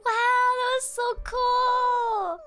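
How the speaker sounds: slow and choked up with tears; crying while gushing; teary. Real, not acted. Moved by the story ending.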